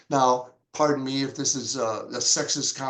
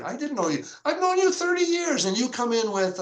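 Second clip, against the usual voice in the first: much higher in delighted shock; surprised; 8 semitones higher than usual. Real, not acted. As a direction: gasp at a friend's surprise choice. Surprised at friend's music pick.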